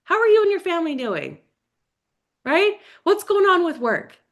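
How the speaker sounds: high, cheery catch-up call voice; gushing friendly caller. Retold or imitated. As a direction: gush like you've missed an old friend. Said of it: "if you reach out to somebody".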